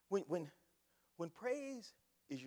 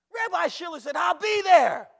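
full-force high shout; very loud, high, exultant retelling; triumphant preacher's shout; 10 semitones higher, 20 dB louder than usual. Retold or imitated. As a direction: holler it out to the whole church. Shouted repeat of quiet line.

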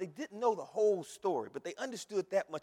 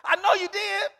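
high, loud shouted preaching with laughter; preaching at full force; 10 semitones higher, 15 dB louder than usual. Real, not acted. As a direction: whip the congregation up to the climax. Preaching climax.

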